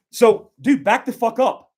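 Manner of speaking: sharp, high, loud command to back off; loud warning. Shown as a demonstration. Instructor demonstrating a shout at a robber, loud warning. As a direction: bark the order so he steps away. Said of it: "prepared for war".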